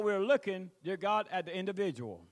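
ordinary talk

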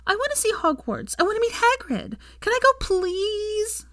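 put-on very high little-girl begging; high-pitched childish pleading. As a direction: beg like an eager child, drawing out the please. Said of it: "Rose pleaded".

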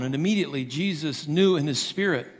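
ordinary talk, nothing marked

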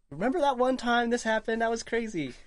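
mock excited remember-when; nostalgic excitement. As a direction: recall the wild moment fondly. Imitating later fond recollection.

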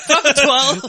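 put-on loud, high parody of an Irish accent; mock Irish accent. Co-host teasing friend's botched accent, loud mock Irish. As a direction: echo it back in a hammed-up accent. Mimicking the co-host's accent shout.

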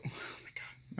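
exasperated whisper. Real, not acted. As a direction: breathe it out under your breath. Exasperated aside about early wakeup.

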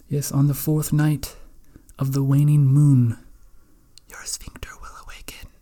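mystic prophecy fading to a whisper; fortune teller. Acted. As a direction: intone the prophecy, then whisper. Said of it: "an oracle or a fortune teller".

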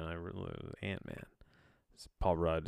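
ordinary talk, nothing marked

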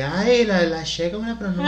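imitación burlona, más aguda y fuerte; burla imitada. Retold or imitated. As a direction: remeda entre risas la reacción de otros. Imita burlándose de cómo pronuncian la che. (burla juguetona, aguda y risueña)

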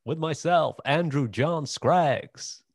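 big radio-host welcome, slowly naming himself; announcer intro. Shown as a demonstration. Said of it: "pressure now to do an accent".

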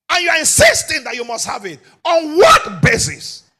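loud, ringing sermon delivery; rising pulpit shout; full-force preaching. Acted. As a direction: drive it home to the congregation. Preaching climax.

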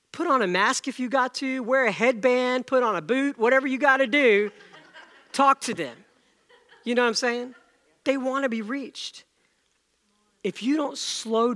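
fired-up preacher pushing the crowd; loud preaching. Real, not acted. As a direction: raise the voice, urging the room. Preaching with force.

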